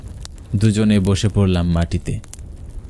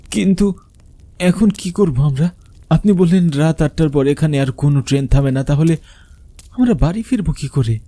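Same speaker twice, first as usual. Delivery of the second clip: much higher, anxious questioning; worried plea; 6 semitones higher than usual. Acted. Narrator asking the old man how to get home, worried and high. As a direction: fret about being stranded.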